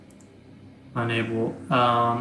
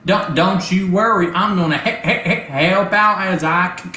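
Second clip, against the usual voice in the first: high, loud, stuttering robot voice; a glitchy robot stammering its words, pitched up; 5 semitones higher, 3 dB louder than usual. Acted. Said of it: "a robotic voice come over and goes".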